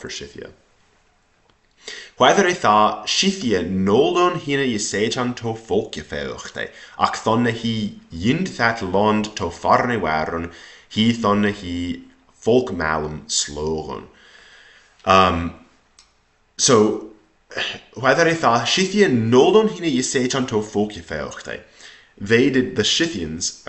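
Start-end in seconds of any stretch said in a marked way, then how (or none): 2.2-14.1 put-on deliberate reading with Old English vowels and consonants; Old English recitation. Shown as a demonstration. Teacher reading about Scythia to students, Old English recitation. 17.9-21.6 put-on higher-pitched reading aloud in Old English; Old English recitation. Shown as a demonstration. Teacher rereading before translating, Old English recitation.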